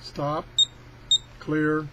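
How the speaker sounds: ordinary delivery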